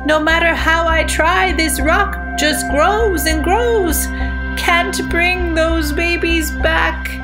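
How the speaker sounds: high, slow despairing call from above. Acted. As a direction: call down to the valley. Buzzard calls down, failing.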